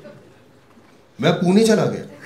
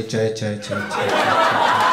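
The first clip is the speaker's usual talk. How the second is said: echo; 6 semitones lower, slower than usual. Retold or imitated. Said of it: "पीछे से आवाज आई".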